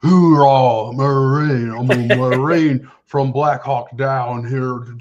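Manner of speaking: mocking a British actor's slow, overdone American Marine drawl; hammed-up fake American Marine, slow and loud; bad American accent.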